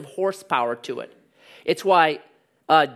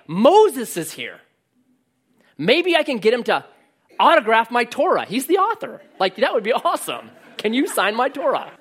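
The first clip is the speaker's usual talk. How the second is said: higher, louder, starstruck babble; blurting fan Peter; 6 semitones higher, 4 dB louder than usual. Retold or imitated. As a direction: gush like a fan wanting an autograph. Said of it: "he kind of blurts out".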